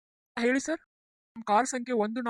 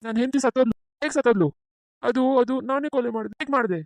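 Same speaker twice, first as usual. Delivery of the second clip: louder, frantic denial; heated shouting; 3 semitones higher, 6 dB louder than usual. Acted. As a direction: insist loudly, losing control.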